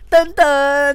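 a high, loud sung dun-dun sting; dramatic dun-dun. Retold or imitated. Telling viewers about her shock, a loud dun-dun sting. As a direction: mimic a shock sound effect, punchy.

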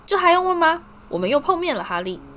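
bright booming showman call; cheerful loud. A narrator's reading. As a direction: announce it grandly to all. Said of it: "愉快地大声说".

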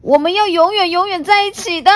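loud, fast, falling firm; fierce vow. Acted. T-rex vowing forever together before attacking, loud and firm. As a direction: swear it before charging.

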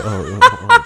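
high strangled uh-uh-uh laugh; awkward stammering laugh. Retold or imitated. Actor answering the lowball offer with a high strangled laugh. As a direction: choke out a helpless laugh. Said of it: "我说".

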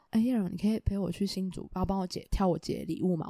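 soft, low mumbled request; imitating a quiet voice. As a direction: ask timidly, almost inaudible. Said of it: "他讲话非常小声".

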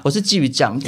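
usual speaking voice

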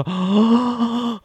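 sharp high gasp of shock; mock horrified gasp; retold gasp. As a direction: suck in air as if seeing blood. Retelling seeing own blood.

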